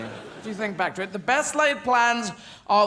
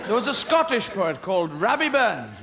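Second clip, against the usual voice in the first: ordinary delivery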